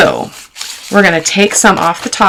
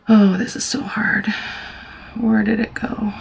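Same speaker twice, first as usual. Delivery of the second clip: frustrated whisper; 3 semitones higher, 8 dB quieter than usual. Real, not acted. Frustrated crafter whispering to herself.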